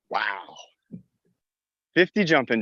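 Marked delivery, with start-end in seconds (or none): 0.1-0.6 breathless wow. Real, not acted. Just finished fifty jumping jacks.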